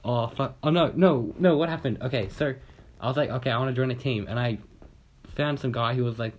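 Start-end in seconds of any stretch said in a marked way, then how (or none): none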